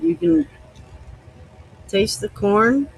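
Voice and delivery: ordinary talk, nothing marked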